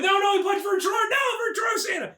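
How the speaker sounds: high-pitched fight hype; hammed-up brawl commentary; mock-frantic shout.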